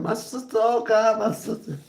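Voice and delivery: high hammed-up wailing over "my sister"; mock wail. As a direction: overdo the grief, pitched high.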